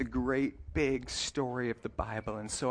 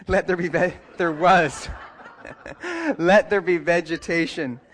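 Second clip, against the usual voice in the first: louder, higher, laughing through the words; real amused laughing; 4 semitones higher, 6 dB louder than usual. Real, not acted. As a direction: laugh at your own slip while reading on. Laughs at own slip while reading.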